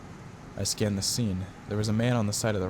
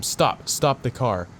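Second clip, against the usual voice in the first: loud, raised command; urgent shout; 4 semitones higher, 5 dB louder, much slower than usual. Acted. Officer to a car barreling at him, urgent shout. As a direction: call out to halt a driver.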